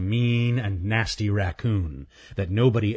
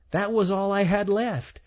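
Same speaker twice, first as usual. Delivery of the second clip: high, stammering squirrel voice; a timid squirrel tripping on words; nervous stuttering squirrel; 12 semitones higher than usual. Acted. Stanley's voice.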